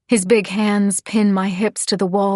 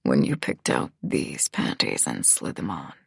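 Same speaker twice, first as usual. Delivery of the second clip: very low, quiet male character; deep, low-volume grunted question; gruff grunt; 7 dB quieter than usual. A narrator's reading. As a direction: keep it deep down and hushed. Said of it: "he grunts".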